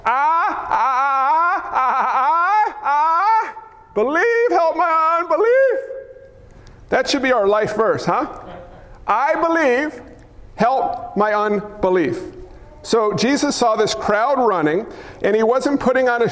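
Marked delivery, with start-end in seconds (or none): 0.0-5.8 high, stammering wail into a plea; acted desperate cry. Father begging Jesus for belief, high anguished cry. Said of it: "The Greek reads this way". 9.1-12.2 measured declaration. Shown as a demonstration. Preacher offering a life verse, slow and deliberate.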